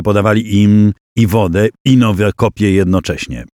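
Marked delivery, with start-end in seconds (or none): none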